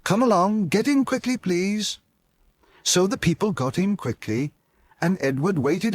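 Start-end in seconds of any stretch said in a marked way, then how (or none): 0.0-2.0 higher, brisk urging voice; eager engine. Acted. Edward calling the people to get in quickly, higher eager voice. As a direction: hurry the passengers along.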